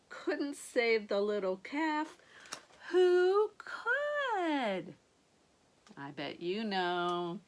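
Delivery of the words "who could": anguished wail. A narrator's reading. Despairing question in story.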